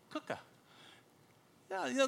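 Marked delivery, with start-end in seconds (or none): 0.1-0.4 "kuka" with short oo as in "book"; short-vowel pronunciation. Shown as a demonstration. Repeating the Australian correction, "kuka" with a short oo. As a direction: say "kuka" with the quick vowel of "cook". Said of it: "let me know that it was".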